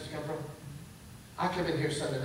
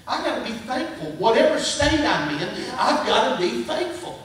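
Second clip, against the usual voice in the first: raised, high preacher's declaration; impassioned sermon resolve; emphatic preaching; 7 semitones higher, 8 dB louder than usual. Real, not acted. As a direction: drive it home to the congregation, building louder. Preaching at full force.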